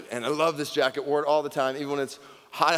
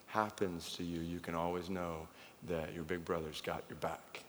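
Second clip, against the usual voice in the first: hushed, lowered voice for a brother's promise; soft retold whisper; 7 semitones lower, 12 dB quieter than usual. Brother whispering reassurance in his ear, hushed and soft. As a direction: murmur it close like a secret. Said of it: "he just whispers in my ear".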